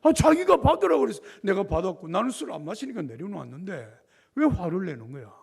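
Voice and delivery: indignant inner protest. Retold or imitated. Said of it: "속으로는 그런 거야".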